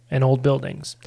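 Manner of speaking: ordinary delivery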